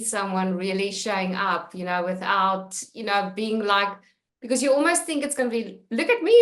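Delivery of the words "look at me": higher, louder "look at me" call; lightly hammed-up attention seeker; mock show-off. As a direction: show off for attention, half-joking.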